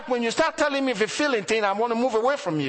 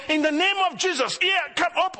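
mock yelling prayer; 10 semitones higher than usual. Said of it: "I was yelling".

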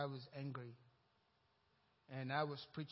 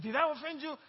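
worried mother; 10 semitones higher, 9 dB louder than usual. Acted. Mother's question repeated.

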